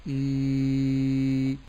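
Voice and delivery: إي held long; drawn long vowel. Shown as a demonstration. Sheikh showing the madd ya, long and even. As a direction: sustain a long إي. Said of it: "وأقول إي".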